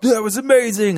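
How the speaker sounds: high, loud mock amazement; gushing excitement. Fan recalls first viewing, hammed-up high excitement.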